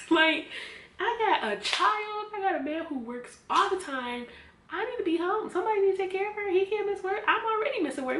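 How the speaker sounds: high, loud, rushed pleading to go home; frantic protest. Retold or imitated. A mother pleading with the doctor to let her go home, frantic and high. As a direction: protest in a rising panic. Protesting staying ten weeks in hospital.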